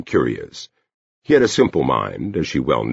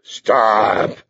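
higher, stretched warning growl; talking dog; 6 semitones higher than usual. A narrator's reading. Dog bars the girl's way, high drawn-out warning. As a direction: draw the word out like a guard dog. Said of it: "the dog told her".

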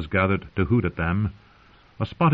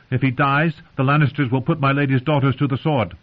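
earnest knight; 5 semitones higher than usual. Acted. Brienne pleads her case.

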